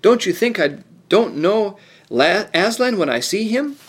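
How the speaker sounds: Lucy snapping back in protest; indignant outburst. Acted. Lucy, hurt by the dwarf's doubt, answers indignantly.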